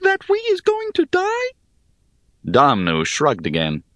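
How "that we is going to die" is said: small high piping voice. Acted. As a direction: pipe it up like a tiny creature. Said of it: "piped Shim".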